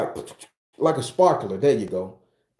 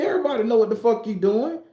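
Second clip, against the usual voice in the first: pitched-up, half-shouted retort; mock yell; 8 semitones higher, faster than usual. Young dealer snapping back at the girl, high half-shout. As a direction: fire it back at her, voice jumping high.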